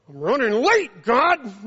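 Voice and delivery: loud, high-pitched plea; exasperated mock protest. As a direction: whine loudly at God. Acted protest to God.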